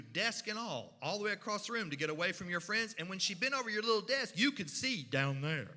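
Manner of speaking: ordinary delivery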